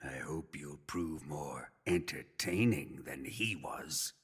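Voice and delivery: the torturer whispers his threat to the prisoner; menacing whisper. Acted. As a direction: breathe the threat into his ear.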